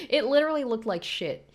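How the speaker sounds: giggling disbelief; laughing. Real, not acted. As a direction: blurt it out mid-laugh. Laughing at the food.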